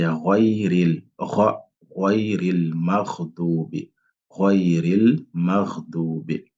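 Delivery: ghayril maghdubi lent, r roulé net; ra bien prononcé. Shown as a demonstration. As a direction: appuyer le ra de ghayril. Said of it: "il faut bien prononcer le ra". (ghayril lent avec un ra clair)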